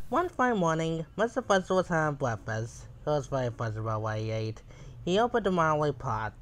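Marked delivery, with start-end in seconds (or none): none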